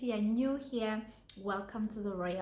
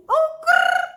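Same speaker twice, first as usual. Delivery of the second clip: high loud mock growl; playful growl; 15 dB louder than usual. Vlogger growling playfully at her viewers. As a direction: growl like a cute kitten.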